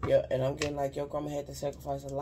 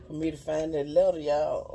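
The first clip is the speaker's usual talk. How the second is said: ordinary delivery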